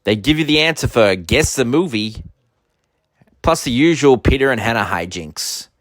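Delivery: loud, big show-intro announcer. Acted. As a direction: announce it like a TV promo.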